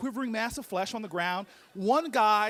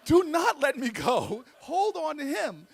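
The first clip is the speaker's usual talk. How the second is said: slow, raised pleading; mock urgent plea; 6 semitones higher, 4 dB louder, slower than usual. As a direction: plead urgently, drawing out the words. Body pleads against the ego.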